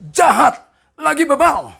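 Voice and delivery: high, very loud punched words; emphatic outburst. Real, not acted. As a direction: hurl out each harsh word. Preacher hammers the harsh words. (seruan lantang penuh tekanan)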